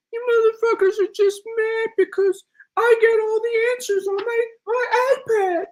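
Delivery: mocking impression. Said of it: "You sound like the character from Shark Tales".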